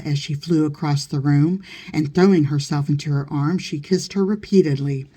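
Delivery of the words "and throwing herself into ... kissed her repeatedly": plain reading voice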